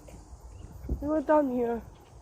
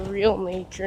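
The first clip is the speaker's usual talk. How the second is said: ordinary delivery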